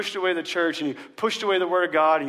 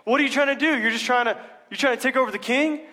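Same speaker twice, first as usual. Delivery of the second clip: much higher, louder accusing challenge; intimidating accusers; 7 semitones higher, 3 dB louder than usual. Retold or imitated. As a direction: lean in and accuse him like a threatening official. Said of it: "They turn to intimidation".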